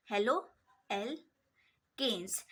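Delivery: "Halo alkanes" drawn out with gaps between the parts; slow, broken-up term. Shown as a demonstration. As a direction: dictate "halo alkanes" slowly, piece by piece. Repeats term slowly in parts.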